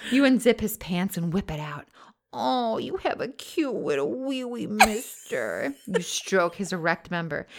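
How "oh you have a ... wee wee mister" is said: put-on baby talk. Cooing to boyfriend.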